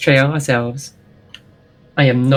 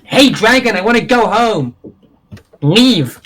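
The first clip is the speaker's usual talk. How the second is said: shouting at dragon; 7 semitones higher than usual. Acted. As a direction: yell up at the dragon to leave. Player character yells at dragon.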